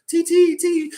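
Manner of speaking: very high, silly imitation; playful tea mimicry. Imitating two players' pre-game tea ritual, playful falsetto. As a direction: squeal it in mock-dainty fun.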